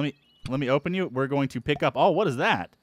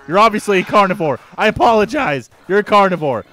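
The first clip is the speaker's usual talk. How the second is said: loud exasperated call; 3 semitones higher, 8 dB louder than usual. Real, not acted. Shouting at a chasing monster.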